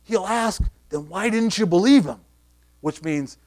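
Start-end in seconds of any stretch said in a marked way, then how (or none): 0.0-2.2 higher, slow, plotting voice; a hint of worried priests conferring; scheming elders. Elders whispering together in a huddle, lightly voiced plotting. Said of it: "they say very plainly".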